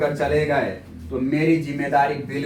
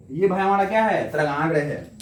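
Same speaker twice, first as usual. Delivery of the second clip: ordinary talk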